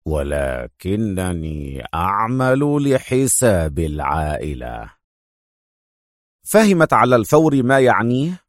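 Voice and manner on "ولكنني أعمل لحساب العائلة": much lower, very slow and soft; sinister reveal. Acted. The mafia inspector revealing himself, low and slow. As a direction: let the menace sink in slowly. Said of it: "ثم برقت عيناه".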